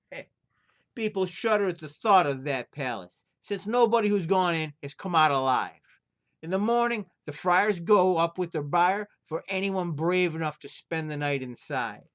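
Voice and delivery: a fussy innkeeper refusing a guest. Acted. Innkeeper warns of haunted palace.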